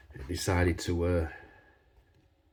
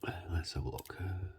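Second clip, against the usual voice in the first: whispering; 2 semitones lower, 14 dB quieter than usual. Real, not acted. Quiet focus while picking lock.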